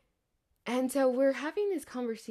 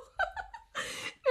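breathless laugh; 5 dB quieter than usual. Real, not acted. Laughing after pineapple shriek.

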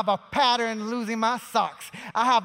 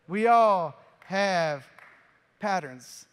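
drawn out, very slow, with a pause before "patterns"; slow, drawn-out emphasis; 2 semitones lower, 3 dB louder, much slower than usual. Shown as a demonstration. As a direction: let it sink in, word by word.

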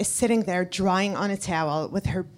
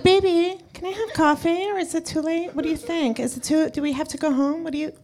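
put-on baby voice; 5 semitones higher than usual. Husband asking wife for coffee in a mild baby voice. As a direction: coo the question like a small child. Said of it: "I did it in a baby voice".

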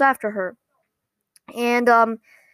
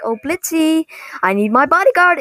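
higher, hammed-up call for help; imitating a theatrical damsel's plea; mock plea for rescue; 5 semitones higher, 4 dB louder than usual. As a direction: call playfully for your bodyguard. Acting a playful call for rescue.

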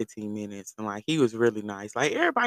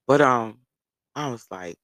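ordinary delivery

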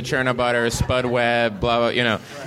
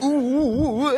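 high, hammed-up "Ooh, ooh, ooh"; slower than usual. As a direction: lay the mustard on thick, way up high. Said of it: "puts a lot of mustard on that first line".

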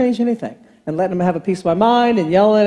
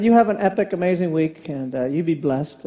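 softer, lower, kindly blessing; warm blessing; 6 semitones lower, 5 dB quieter than usual. Retold or imitated. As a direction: bless him gently, fading off. Blessing the agent.

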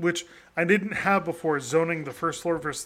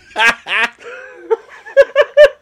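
loud laughter; 13 semitones higher, 12 dB louder than usual. Real, not acted. Laughing at own promo.

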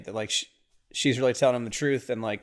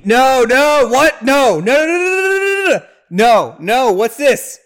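much higher, loud panicked string of no's; dreading shriek; 12 semitones higher, 11 dB louder, slower than usual. Real, not acted. Watching the movie, dreading the garbage disposal, high panicked no's. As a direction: squeal in horror at what's coming.

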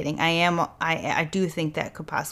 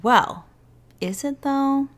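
skeptical; 7 semitones higher, slower than usual. Real, not acted. Skeptical twist on the evidence.

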